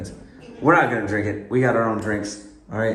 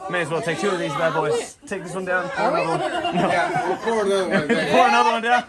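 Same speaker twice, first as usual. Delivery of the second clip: laughing tipsy talk; 7 semitones higher than usual. Real, not acted. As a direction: giggle through it, loosened up. Taking shots, laughing.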